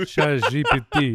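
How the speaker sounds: French accent. Shown as a demonstration. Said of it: "do that again?".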